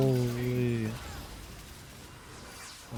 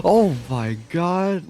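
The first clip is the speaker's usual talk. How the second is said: slow, stretched, louder disbelief; amazed; 5 semitones higher, 5 dB louder, much slower than usual. Real, not acted. Streamer watching a teammate's execute, slow amazed exclamation. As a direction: draw it out in awe.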